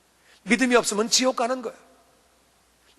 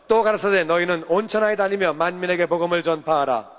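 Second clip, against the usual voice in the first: steady, formal Bible reading, a little lower; scripture reading; 4 semitones lower than usual. Acted. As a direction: read the verse aloud for the congregation. Leads responsive scripture reading.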